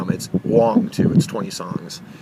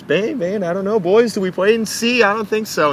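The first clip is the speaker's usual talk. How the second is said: high playful mock Bruce voice; half-joking Bruce; 10 semitones higher than usual. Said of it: "he's, like, half joking".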